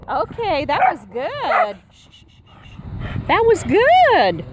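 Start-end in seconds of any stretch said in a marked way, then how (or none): 3.3-4.4 high, louder, slow delighted praise. Real, not acted. Owner gushes high and loud over the run. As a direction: stretch it out, thrilled.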